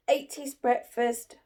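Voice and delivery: ordinary delivery, nothing marked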